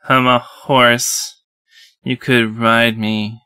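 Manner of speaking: slow, deliberate deadpan; slow deadpan repeat. Acted. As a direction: drag it out, straight-faced. Repeats horse innuendo.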